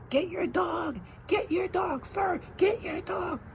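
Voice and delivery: shrill high mock yell of a lady, far above his own voice; mocking screaming lady. Man imitating the neighbor lady screaming at him about his dog. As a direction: squeal it like the panicked neighbor. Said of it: "screaming at me with her".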